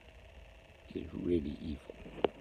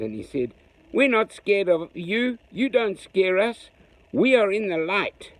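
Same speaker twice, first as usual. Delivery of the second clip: put-on loud defiant child calling into the dark; brave calling out; 5 semitones higher, 4 dB louder than usual. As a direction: dare them to come out. Zooks defies the creatures bravely.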